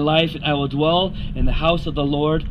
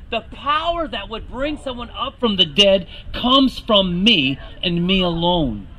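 ordinary talk

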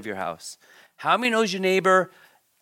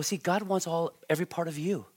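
ordinary delivery

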